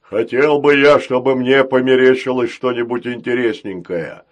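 loud, hearty booming voice; jolly giant's boom; cheerful giant robot. Acted. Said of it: "не утративший своей жизнерадостности". (жизнерадостный бас великана)

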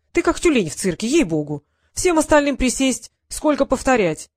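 loud exasperated coach yelling at the gym; scolding coach. Acted. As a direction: bark at the clumsy boy and the team, fed up. Coach scolding tired kids.

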